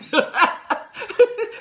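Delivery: high, loud laugh bursting out; loud burst of laughter. Real, not acted. Laughs about biting her tongue.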